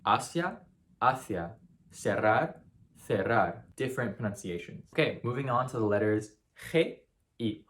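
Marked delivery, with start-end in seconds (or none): 0.1-1.6 Asia with s, hacia with th; Spain contrast. Shown as a demonstration. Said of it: "pronounce these words differently". 1.9-3.6 the first cerrar with s, the second cerrar with th; s then th; Spain contrast. Shown as a demonstration. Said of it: "pronounce these words differently".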